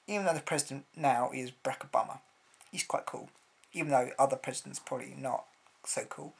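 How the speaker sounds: ordinary talk, nothing marked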